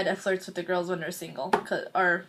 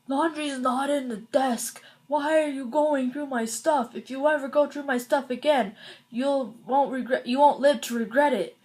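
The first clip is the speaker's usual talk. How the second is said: mock angry brother; 4 semitones higher than usual. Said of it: "he's like".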